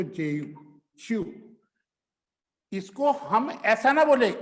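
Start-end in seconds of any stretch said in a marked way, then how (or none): 0.0-1.2 च्यू said long, like chew; long chu sound. Shown as a demonstration. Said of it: "लंबा बोलेंगे इसको".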